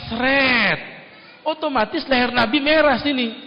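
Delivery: normal talking voice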